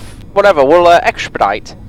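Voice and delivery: put-on lightly done Dutch accent; a hint of a Dutch pilot. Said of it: "that amazing Dutch accent".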